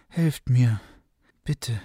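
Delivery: a faint plea, fading out; exhausted woman begging for help at the gate; with her last strength. A narrator's reading. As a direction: mit letzter Kraft. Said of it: "mit letzter Kraft".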